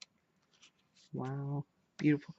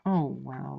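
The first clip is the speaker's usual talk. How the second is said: ordinary talk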